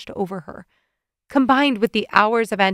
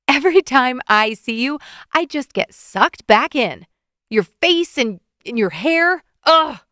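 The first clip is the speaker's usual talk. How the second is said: louder, exasperated rant ending in ugh; frustrated groan; 3 semitones higher, 5 dB louder than usual. A narrator's reading. Woman vents to Evan, louder, ending in a groan. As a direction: vent your frustration and groan. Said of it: "She groaned in frustration".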